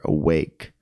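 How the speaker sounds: "awake" with the weight on WAKE; a-WAKE, short first vowel, long second syllable; stress on second syllable. Shown as a demonstration. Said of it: "You slow down and say it louder".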